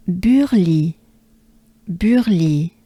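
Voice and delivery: Burly with French u and throat r; French pronunciation. Shown as a demonstration. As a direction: model French "Burly" slowly for learners. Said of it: "Let's break it down".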